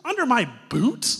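high, loud disbelieving question; incredulous Woody. Retold or imitated. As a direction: fire back in shocked disbelief. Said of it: "And she goes".

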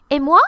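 "Et moi" rising on "moi"; real question. Shown as a demonstration. Helper waiting to be assigned a task, rising question. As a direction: ask "Et moi" wanting an answer, voice going up. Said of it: "it's a real question".

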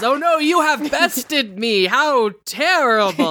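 high, hammed-up mock defeat; badly faked theatrical dismay; Richard. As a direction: feign being bested, stretching the last words. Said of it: "Richard says".

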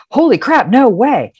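high, exclaiming disbelief; gasp-worthy astonishment, pitch swooping up; expected amazed response. Retold or imitated. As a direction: imitate a wowed friend. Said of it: "I thought her response would be something like".

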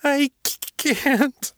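put-on high, choked boy's sob; crying child in pain; sobbing. A narrator's reading. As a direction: stammer it through tears, very high. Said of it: "the boy sobbed".

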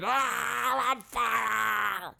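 slow wailing cry of pain; panicked cry. Acted. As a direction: wail as the flames catch. Character catches fire.

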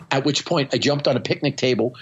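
usual speaking voice

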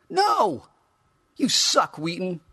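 high, loud bratty outburst; bratty yell. Acted. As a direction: scream it like a spoiled kid. Figure yells insult.

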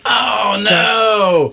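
high, drawn-out lament; hammed-up commiserating moan; mock dismay. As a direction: wail it in playful disappointment. Host reacts to guitar being dropped.

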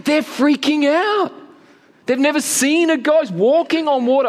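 high, worked-up exclamation; dramatized panic. Acted. Preacher describing frightened disciples, high and agitated. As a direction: push the pitch up, conveying their alarm.

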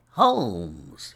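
loud, falling call of a name; insistent cry. A narrator's reading. Visitor calling to the sick Holmes, insistent cry. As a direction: call out sharply to rouse someone. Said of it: "he cried".

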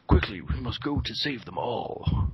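slow, stilted overdubbed movie English. Retold or imitated. Mocking the dubbed martial artist in a slow, stilted dubbed accent. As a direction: deliver it like a badly dubbed ninja film. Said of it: "got that really thick".

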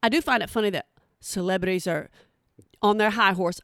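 normal talking voice

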